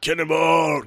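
high called-out cannonball; cannonball yell. Retold or imitated. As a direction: sing it out like a kid jumping. Quoting the film's cannonball yell.

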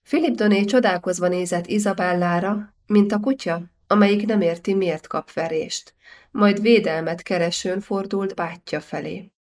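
ordinary delivery, nothing marked

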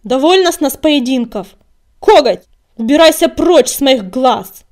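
loud, angry command; furious leader's shout; barked order. A narrator's reading. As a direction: bark at them to clear off. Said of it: "рявкнула синяя звезда".

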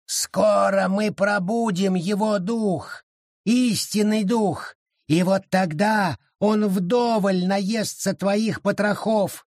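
high, slow ominous creature voice; gloating creature threat; menacing forest spirit. A narrator's reading. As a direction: promise doom slowly.

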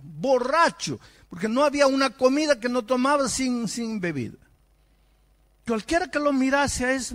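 loud, high, driving sermon voice; fiery preaching. Acted. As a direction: preach it hard and rising, pushing each charge. Preacher builds to full force.

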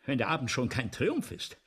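ordinary talk

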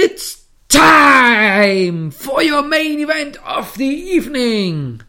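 booming, drawn-out ring announcer call; Bruce Buffer announcer. Retold or imitated. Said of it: "fängt er seine berühmte ... Satz an". (Ringsprecher wie Bruce Buffer)

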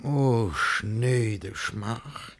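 drawn out, very slow groan of shame; slow lament. Acted. Humiliated guardian alone, lamenting very slowly. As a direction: stretch every word in misery. Lament after the suitor leaves.

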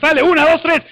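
ordinary delivery

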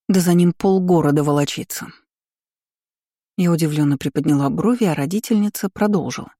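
usual speaking voice

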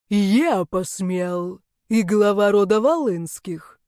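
put-on very slow, growling young man stepping in; rough growl. A narrator's reading. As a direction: growl it as a protective challenge. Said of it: "грубый рык Яни". (грубый рык, очень медленно)

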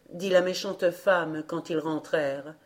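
ordinary delivery, nothing marked

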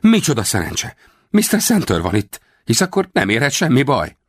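high, fawning feigned relief; Rawlins, sly actor. A narrator's reading. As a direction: overplay the joy to fool them. (magas, hízelgő színlelt öröm)

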